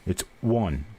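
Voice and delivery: "one" with a w glide like won; Appalachian "one" for on. Shown as a demonstration. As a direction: say "It's one" the mountain way. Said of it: "W O N instead of just O N".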